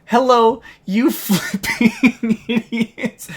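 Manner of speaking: high, loud jeer breaking into laughter; laughing exasperation. Real, not acted. Reader mocks plaintiffs' contradictory claim, laughing in disbelief. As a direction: stretch it out through a laugh.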